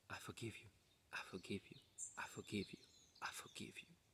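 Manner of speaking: breathy repeated whisper, very soft; whispered mantra. Retold or imitated. He repeats the forgiveness mantra to his torturers, whispering. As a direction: whisper it over and over like a prayer.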